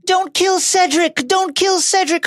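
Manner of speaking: high mocking whimper of a nightmare; shouting in sleep. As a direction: mimic someone crying out asleep. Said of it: "he's been screaming in his sleep".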